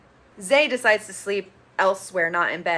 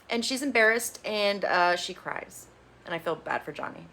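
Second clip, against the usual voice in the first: usual speaking voice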